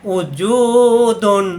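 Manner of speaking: উজুদুন with জু drawn out slowly; stretched vowel. Shown as a demonstration. Teacher showing the long জু in উজুদুন. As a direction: lengthen the জু in উজুদুন. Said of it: "জুকে লম্বা করতে হবে".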